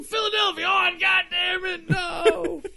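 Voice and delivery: high, loud, agonized outburst; hammed-up shout of defeat; mock frustrated yell. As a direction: howl it in comic despair, drawing it out. Retells botched answer on trivia show.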